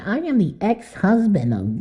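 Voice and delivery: high, grand put-on announcement; mock self-important. Host imagining himself pulling rank as ex-husband, self-important. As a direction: pitch it up as a pompous boast. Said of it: "That's like me saying".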